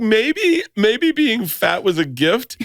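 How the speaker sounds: retelling his panic about losing weight, laughing; laughing quote. Retold or imitated. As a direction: quote your panicked self while laughing. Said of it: "I was like".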